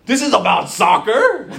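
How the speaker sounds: comic exasperated disbelief, punched loud; retold disbelief. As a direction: burst out in mock outrage. Retold disbelief at kid's reason.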